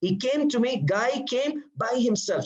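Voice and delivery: high-pitched insistence, "by himself" stretched out; emphasis on himself. Shown as a demonstration. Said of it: "So by himself is tawqid".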